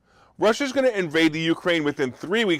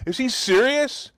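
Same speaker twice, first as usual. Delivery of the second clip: higher and slow, disbelieving; incredulous; 6 semitones higher than usual. Real, not acted. Vlogger reacting to the President's claim, incredulous. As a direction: ask it as if the claim is absurd.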